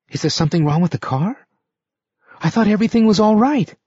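high, fast, rising panic; anxious pleading. A narrator's reading. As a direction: plead as she's pushed out. Sylvia pleading, bewildered.

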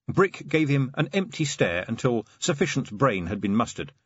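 ordinary delivery

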